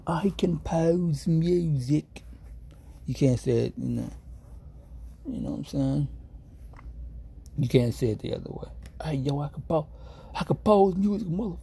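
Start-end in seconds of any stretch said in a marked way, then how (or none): 0.1-2.2 slow, breathy whisper in a posh English accent; whispered England voice. Shown as a demonstration. Streamer showing viewers the English voice, whispered. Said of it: "You have to have the England voice". 9.0-11.6 higher, punchy street swagger on compose; street tough voice. Shown as a demonstration. Streamer showing chat the wrong way, mock street swagger. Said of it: "they're not gonna take you seriously if you say it like that".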